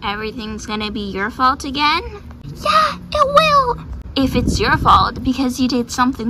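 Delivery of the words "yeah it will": very high, petulant horse voice; whiny insistence. Acted. Horse arguing about being blamed, high whiny insistence. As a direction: insist in a squeaky whine.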